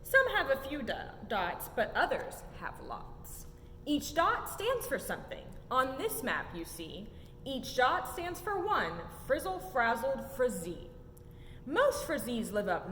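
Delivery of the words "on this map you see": ordinary delivery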